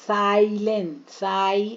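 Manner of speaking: साइलेंट, साई with the "ai" glide drawn out; long I sound. Shown as a demonstration. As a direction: slowly stretch the "sigh" in साइलेंट, साई. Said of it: "आई sounds".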